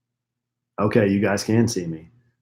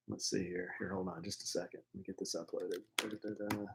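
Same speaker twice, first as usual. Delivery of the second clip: whisper; 5 semitones lower, 23 dB quieter, faster than usual. Real, not acted. Whispering near the mic.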